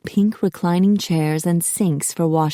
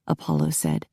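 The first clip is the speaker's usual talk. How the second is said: usual speaking voice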